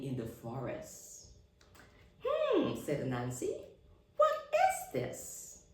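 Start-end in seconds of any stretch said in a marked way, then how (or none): 2.2-2.7 high musing hum; a curious high hmm; thoughtful hum. Acted. Anansi finds a strange light, curious high hum. 4.2-5.6 high, slow curious question; high wondering voice. Acted. Anansi finds a glowing globe, slow high wonder.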